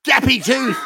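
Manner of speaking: high mock yell of dickhead; mock scream. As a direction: squeal the insult up high in jest. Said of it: "he starts just screaming".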